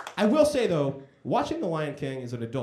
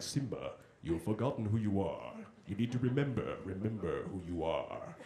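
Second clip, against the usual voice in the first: Mufasa from the clouds; 8 semitones lower, 9 dB quieter than usual. Retold or imitated. Mufasa in the clouds calling his son, solemn and low. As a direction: intone it like a wise dead king. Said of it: "He's like".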